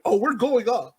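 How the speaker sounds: ordinary talk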